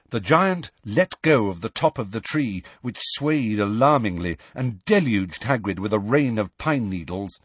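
ordinary delivery, nothing marked

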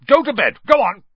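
put-on high, loud order to the boy; loud high snarl; Uncle Vernon snarling. A narrator's reading. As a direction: snap him off to bed. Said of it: "he snarled at Harry".

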